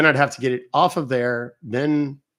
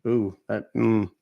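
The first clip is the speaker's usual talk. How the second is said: usual speaking voice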